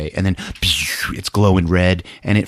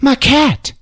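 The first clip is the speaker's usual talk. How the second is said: very high, louder, fast fretting; squeaky fretting; 3 dB louder than usual. Retold or imitated. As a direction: rush it out in a squeaky worry. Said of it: "Geraldine's like".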